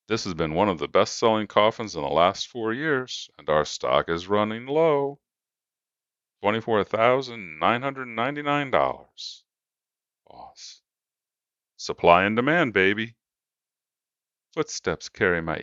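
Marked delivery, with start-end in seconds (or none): 0.1-9.4 upbeat, louder pushy salesman. A narrator's reading. Salesman continues pitch. 11.8-13.2 louder, cocky gloating; smug salesman. A narrator's reading.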